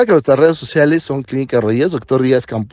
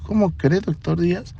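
much softer, gentle mock repeat of her words; soft teasing echo; 3 semitones higher, 7 dB quieter than usual. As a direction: hand her words back quietly, teasing. Doctor softly echoing her words.

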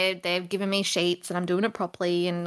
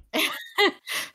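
high breathy laugh; 10 semitones higher than usual. Real, not acted. Laughs at fish names.

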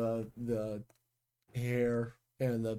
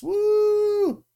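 high, loud cheering woo; excited woo; 9 dB louder than usual. Real, not acted. Wrapping up the episode, a loud high cheer. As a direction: let out a big celebratory whoop.

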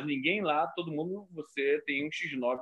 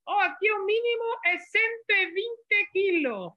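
much higher, slow put-on voice of a skinny prisoner; high slow mocking inmate voice; inmate's voice. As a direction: sneer it like a hardened inmate topping the boast. Skinny inmate answers him.

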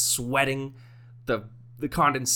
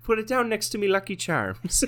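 high cartoon Irish brogue; bad Irish accent; 9 semitones higher than usual. Pub customer ordering from the barman, cartoonish Irish accent. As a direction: ham up a fake Irish accent. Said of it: "I'm not gonna do an Irish accent".

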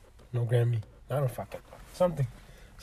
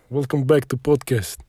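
put-on Russian accent. Host welcoming listeners back to the podcast, mock Russian accent.

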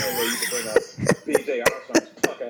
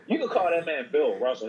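ordinary talk, nothing marked

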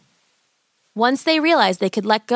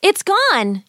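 put-on high-pitched girl crying out; astonished cry; 11 semitones higher than usual. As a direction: cry out in amazement. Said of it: "she cried out".

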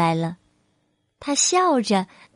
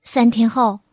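ordinary delivery, nothing marked

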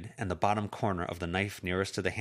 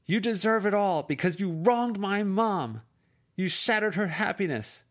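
calling out after him; 13 semitones higher, 6 dB louder than usual. Acted. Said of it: "she called out after him".

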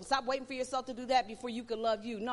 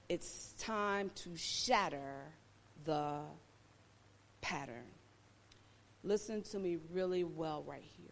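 hushed preaching; 9 semitones lower, 6 dB quieter than usual. Acted. Preacher speaking low and soft to his listeners. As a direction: pull them in close. Quiet sermon passage.

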